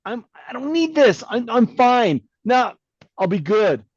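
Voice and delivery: high-pitched mock protest; dismissive protest. Patient resisting the cardiologist, acted-out dismissive protest. Acting out his own past resistance.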